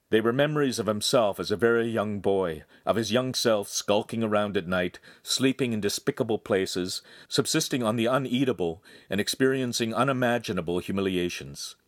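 ordinary delivery

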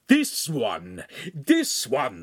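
firm, moderately loud accusation; shouted. Acted. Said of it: "the master shouted".

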